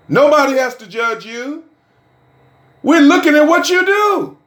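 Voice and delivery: a preacher's ringing appeal; rising preacher call. Acted. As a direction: climb up and drive it home to the congregation.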